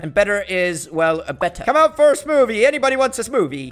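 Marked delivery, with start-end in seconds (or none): 1.6-3.7 high, loud street-seller call; raised-voice sales pitch; vendor calling out. Pretend. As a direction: holler for customers. Calling out for customers.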